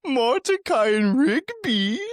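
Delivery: high, slow cartoon character voice. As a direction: squeak it out like a cartoon. Listing cartoon voice impressions.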